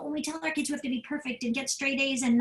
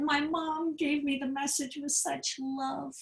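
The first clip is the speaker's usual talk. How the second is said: tearful; 4 semitones higher than usual. Retold or imitated. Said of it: "she was crying".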